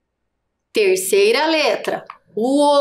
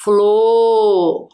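FLOR held long and louder on one vowel; drawn-out syllable; 2 semitones lower, 5 dB louder than usual. Shown as a demonstration. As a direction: sound out FLOR as a single sustained syllable. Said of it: "a primeira sílaba é só". (flor esticado e forte, numa sílaba só)

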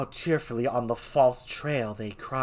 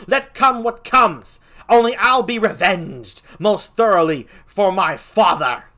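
higher and much louder, furious; raging Laertes; 9 semitones higher, 11 dB louder than usual. Acted. Laertes raging at the king over his father, furious. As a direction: hurl it at the king.

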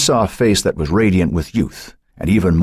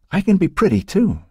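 put-on high-pitched childish boast; insistent little girl; 14 semitones higher than usual. A narrator's reading. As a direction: pipe up like a jealous little sister. Young girl insists to her sister.

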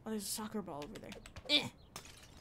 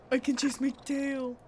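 loud, raised delighted exclamation; excited; 4 semitones higher, 10 dB louder than usual. Real, not acted. Player finding the cat can chase its tail, excited. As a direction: burst out with the discovery.

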